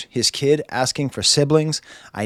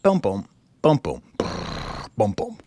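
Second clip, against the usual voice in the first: beatboxing the beat; 3 semitones lower than usual. Retold or imitated. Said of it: "then we get to the song".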